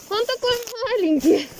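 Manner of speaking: cooing. Real, not acted. Cooing over something small.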